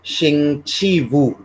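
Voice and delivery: शिंग ची वू said slowly, syllable by syllable; slow Chinese demo. Shown as a demonstration. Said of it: "आज क्या है, अ, फ्राइडे".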